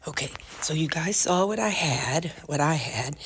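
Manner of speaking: hushed, voiceless whisper. Real, not acted. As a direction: keep it secret at work. Whispering at work potluck.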